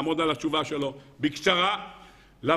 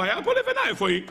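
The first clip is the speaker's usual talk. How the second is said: surprised; 10 semitones higher, faster than usual. Acted. Builder finds brick missing.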